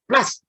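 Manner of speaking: a sharp, loud, high "¡Plas!" like a slap; slap sound. Acted. The speaker mimics slapping a label on someone, loud and sharp. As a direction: smack the word out like a sticker slapped on.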